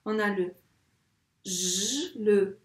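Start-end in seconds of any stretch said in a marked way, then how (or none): 1.4-2.1 J as a long buzzing "zh"; isolated J sound. Shown as a demonstration. Teacher sounding out a word's first sound, drawn out. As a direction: hold the consonant J alone. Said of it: "quels sons on entend".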